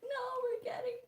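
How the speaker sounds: very high, loud whine of dismay; mock wail. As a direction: wail it in playful despair. Mock wailing at relapsing into cycle.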